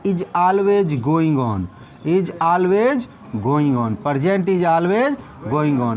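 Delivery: drawn out, very slow; slow dictation. Shown as a demonstration. Teacher dictating to pupils, slow and drawn out. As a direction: stretch each word so they can copy. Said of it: "teacher repeats slowly for writing".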